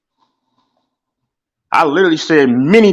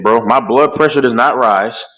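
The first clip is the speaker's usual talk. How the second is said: usual speaking voice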